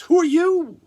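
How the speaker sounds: high, loud startled yelp; startled shout. Acted. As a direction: jolt awake and yelp it. Boaz waking with a start.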